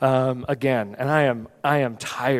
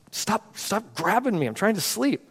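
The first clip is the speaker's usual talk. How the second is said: strained, higher, slow hushed snap; irritated plea; 7 semitones higher, 5 dB quieter than usual. Retold or imitated. Tired dad to his son grabbing him at night, irritated. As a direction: plead through a first bit of anger, voice pitched up. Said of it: "my first little bit of anger".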